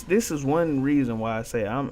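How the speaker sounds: usual speaking voice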